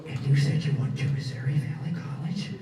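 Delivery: breathy whisper in your ear; whispered question. Retold or imitated. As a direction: lean in too close and whisper. Close breathing officer.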